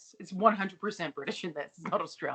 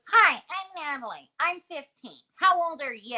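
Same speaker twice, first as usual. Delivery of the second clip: put-on obnoxious teen; 5 semitones higher, 6 dB louder than usual. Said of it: "in an extremely obnoxious manner".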